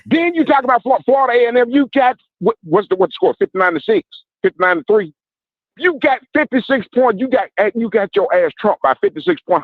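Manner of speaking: high, rapid-fire mocking rundown; trash talk. Real, not acted. Caller listing Southern's blowout losses, mocking. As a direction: pile on the scores to needle them.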